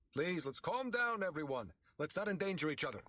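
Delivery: a priest settling a panicked crowd; calming the crowd. A narrator's reading.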